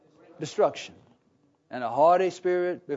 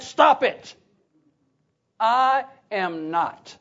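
emphatic refusal, hammering each word slowly at the end; 3 semitones higher, 4 dB louder, much slower than usual. Acted. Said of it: "he emphatically said".

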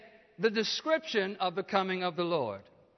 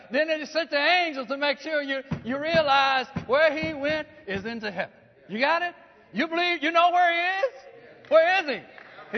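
climbing higher and louder toward the question; rising preacher's fervour; 5 semitones higher, 4 dB louder than usual. Acted. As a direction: build it up and call out to the church. Preacher builds to a call.

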